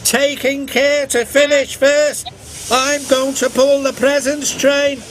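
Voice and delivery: high, cheeky engine voice; replying James. A narrator's reading. James answering Edward's warning. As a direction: answer back cockily. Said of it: "replied James".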